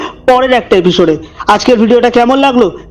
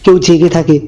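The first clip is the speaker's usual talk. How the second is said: low, hushed storyteller voice; eerie narration, measured and dark; horror story narration; 6 semitones lower than usual. A narrator's reading. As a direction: draw the listener into a creepy tale.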